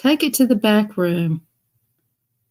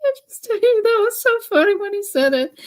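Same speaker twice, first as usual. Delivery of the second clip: laughing; faster than usual. Real, not acted. Cracking up at friend's story.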